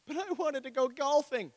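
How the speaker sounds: high pleading complaint; whiny excuse voice. Pretend. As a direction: whine it like a reluctant believer. Acted excuse to Jesus.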